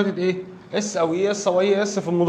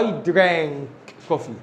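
I drank coffee very slowly; teacher dictating slowly; slow dictation; 2 semitones lower, much slower than usual. Shown as a demonstration. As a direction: drag I drank coffee out for learners. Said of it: "model sentence".

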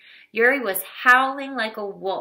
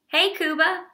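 calling out; 9 semitones higher than usual. A narrator's reading. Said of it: "He waved".

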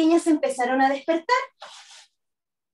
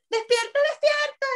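high, urgent little cry; urgent waking call; 12 semitones higher than usual. A narrator's reading. Family shaking the sleeping sisters, high urgent call. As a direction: urge the sleeper awake in a high voice.

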